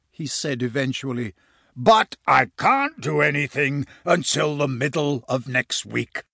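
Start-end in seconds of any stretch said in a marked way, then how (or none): 1.7-6.2 big, resonant giant voice; booming giant, easing off at the end; giant wizard. Acted. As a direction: explain as a ponderous booming wizard.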